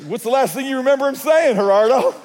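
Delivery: high, loud, laughing question; playful teasing. Retold or imitated. Pastor teasing driver about his own sermon, laughing. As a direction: needle him with a grin in the voice.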